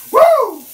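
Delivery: high, loud happy whoop; loud excited woo at the stove. Real, not acted. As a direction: let out a joyful high woo. Excited whoop while cooking.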